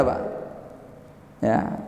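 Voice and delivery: ordinary talk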